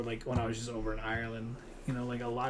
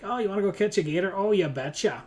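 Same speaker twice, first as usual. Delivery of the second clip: loud, raised mock-Minnesota exchange; Minnesota accent; 6 semitones higher, 6 dB louder than usual. Player acting out northerners planning to catch a gator, mock Minnesota accent. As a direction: imitate two goofy Minnesotans, louder than normal. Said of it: "goofy little words like that".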